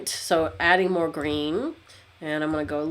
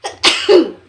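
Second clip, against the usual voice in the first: sudden loud sneeze; a real sneeze bursting out; 10 semitones higher, 12 dB louder than usual. Real, not acted. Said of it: "there was a sneeze in there".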